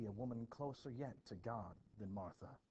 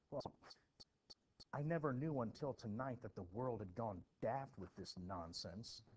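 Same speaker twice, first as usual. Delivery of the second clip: normal talking voice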